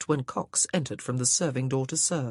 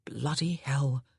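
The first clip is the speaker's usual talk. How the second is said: ordinary delivery, nothing marked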